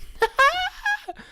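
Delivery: a high squeal of a laugh. Real, not acted. Host laughing at own word mixup, high squealing laugh.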